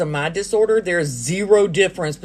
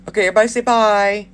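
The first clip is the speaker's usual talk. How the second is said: calling out goodbye; 4 semitones higher, slower than usual. Real, not acted. Signing off the livestream.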